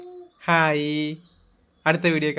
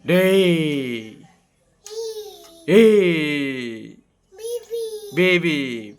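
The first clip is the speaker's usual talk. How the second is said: playful laughing shout; much slower than usual. Real, not acted. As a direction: laugh while calling out. (ചിരിച്ചുകൊണ്ട് വിളിക്കുന്നു)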